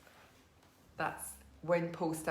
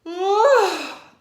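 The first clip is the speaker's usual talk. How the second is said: big, loud, high yawn sound; a long exaggerated yawn, much higher and louder; demo yawn; 13 semitones higher, 16 dB louder than usual. Shown as a demonstration. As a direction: yawn out loud for the class to copy. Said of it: "Did you like my yawn?".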